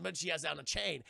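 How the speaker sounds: ordinary talk, nothing marked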